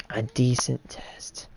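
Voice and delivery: hushed whisper. Real, not acted. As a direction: breathe it out quietly. Testing if others hear.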